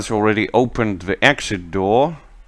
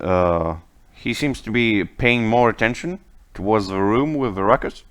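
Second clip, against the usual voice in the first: ordinary talk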